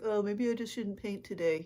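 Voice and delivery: imitating the doubting inner voice; mock reluctant inner voice; put-on self-doubt voice. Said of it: "You love the voice that comes with it".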